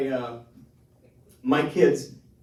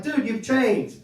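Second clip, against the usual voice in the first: hurt dad; 11 semitones higher, 6 dB louder, much slower than usual. Retold or imitated. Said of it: "I'm like".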